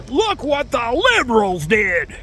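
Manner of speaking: high, drawn-out mock wail; hammed-up cry of blame; mock screaming. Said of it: "And they keep screaming".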